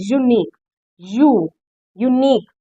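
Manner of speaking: यूनिक, then यू alone with its y glide, then whole; sounding out unique. Shown as a demonstration. Teacher isolates the first sound for students, यूनिक opened on "yoo". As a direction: break off the "yoo" at the front of यूनिक between pauses. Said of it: "तो य sound आ रही है".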